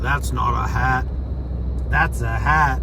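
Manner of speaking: put-on playful Crocodile Dundee Australian accent. Joking about a man's hat in an Australian accent. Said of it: "That was my Crocodile Dundee accent".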